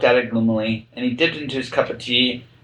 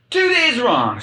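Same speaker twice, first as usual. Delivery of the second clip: sighed; 12 semitones higher, slower than usual. A narrator's reading. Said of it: "sighed the Hatter".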